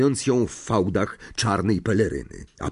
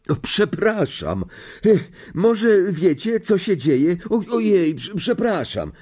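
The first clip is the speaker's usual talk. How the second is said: put-on high, halting, flustered boy; flustered apology; 9 semitones higher, 3 dB louder than usual. A narrator's reading. Neville apologises after falling on Harry in the dark, flustered. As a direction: stumble over apologies in a raised voice.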